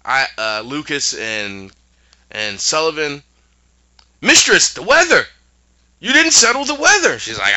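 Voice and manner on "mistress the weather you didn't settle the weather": high, loud panicked servant cry; frantic guard. Retold or imitated. Guard yelling at the witch about the storm, high and loud. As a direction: cry out in alarm to the mistress. Guard voiced in retold scene.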